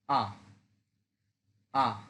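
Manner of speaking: আ short and falling sharply; Mandarin fourth tone. Shown as a demonstration. Teacher shows students the fourth tone, short fall. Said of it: "ফল করবে".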